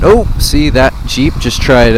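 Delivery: ordinary delivery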